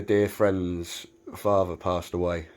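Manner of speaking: normal talking voice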